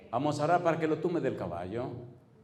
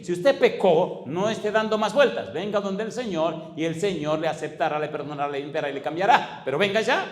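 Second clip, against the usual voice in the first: loud, high altar call; fiery preacher's plea; preaching at full force; 4 semitones higher, 6 dB louder than usual. Acted. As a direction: press the sinner to come now.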